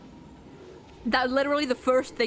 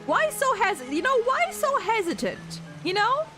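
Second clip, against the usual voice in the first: teasing; 10 semitones higher than usual. Acted. Teasing the characters in bath.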